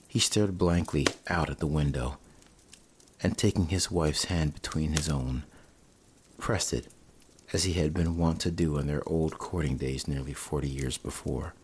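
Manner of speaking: ordinary delivery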